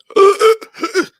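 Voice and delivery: imitated pained squeal; high-pitched squeal. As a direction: squeal like you've just been hit. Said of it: "high-pitched squeal".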